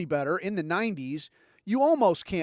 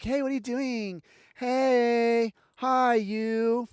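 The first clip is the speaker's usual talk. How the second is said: put-on, higher, drawn-out coaxing greeting; woman angling for dinner; 5 semitones higher, much slower than usual. Woman hitting up a guy for another dinner, mock-sweet. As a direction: imitate her with long, sing-song hellos. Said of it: "She'll hit you up and like".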